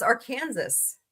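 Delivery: Arkansas said as "ar-KAN-zass"; Arkansas pronounced incorrectly. Shown as a demonstration. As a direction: sound the final S in Arkansas, like "are Kansas".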